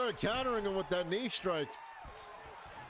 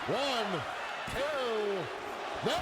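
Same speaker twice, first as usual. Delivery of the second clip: excited; 3 semitones higher, much slower than usual. Real, not acted. Live pin count, kickout.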